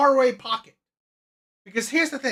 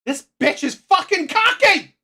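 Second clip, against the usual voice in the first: loud exasperated outburst; 4 semitones higher, 5 dB louder than usual. Real, not acted. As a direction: burst out loud in disbelief. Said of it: "I'm sorry I'm being loud".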